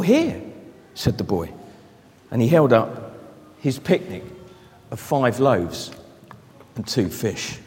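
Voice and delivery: soft, low storytelling voice; reading a story to children, soft and low; story narration. Acted. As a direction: draw the children in quietly.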